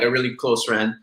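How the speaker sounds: usual speaking voice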